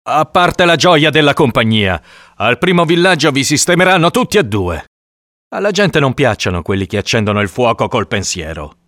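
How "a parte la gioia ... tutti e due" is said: raised, higher-pitched scolding; worked-up man laying out the danger; exasperated warning. Acted. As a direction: warn her loudly and impatiently. Hunter warns the woman, exasperated.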